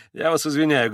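ordinary delivery, nothing marked

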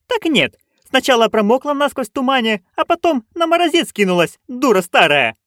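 high, scolding tirade; narrated yelling. A narrator's reading. Nanny scolding her soaked friend, yelling. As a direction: scold at a high pitch, without much extra volume. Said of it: "проорала она".

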